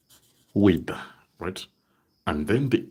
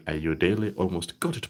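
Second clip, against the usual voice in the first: usual speaking voice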